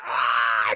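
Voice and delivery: loud imitated creature roar; hammed-up monster yell; monster attack scream. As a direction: roar as you pounce. Monster pounces on victim.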